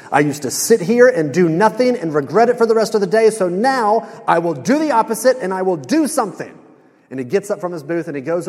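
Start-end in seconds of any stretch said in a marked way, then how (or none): 0.1-6.5 higher, louder acted-out epiphany; retold fired-up vow to change; sudden resolve. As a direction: build a mock-heroic declaration. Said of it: "George kinda has this light bulb moment".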